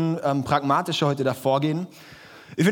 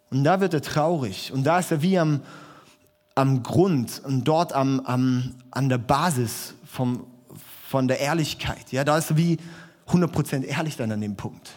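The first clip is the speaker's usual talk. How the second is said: ordinary talk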